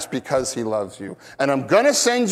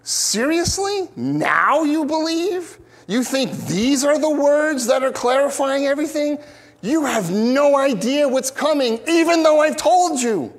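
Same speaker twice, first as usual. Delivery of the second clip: much higher, slowed, disbelieving "seriously?"; incredulous Jesus; 10 semitones higher than usual. Retold or imitated. Preacher voicing Jesus to confused disciples, incredulous. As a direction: raise the voice in stunned disbelief at their claim. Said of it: "the tone of his, of his, what he's saying here is like".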